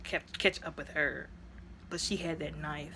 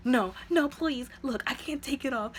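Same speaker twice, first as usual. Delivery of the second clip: higher, pleading mock plea; 7 semitones higher than usual. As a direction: beg him like she's scared, a bit hammed-up. Captive woman pleads.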